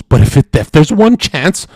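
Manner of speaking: loud, high-pitched mock hysteria; hammed-up panic. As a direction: spiral upward into a screaming imitation of panic. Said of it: "the chaos in their brain goes".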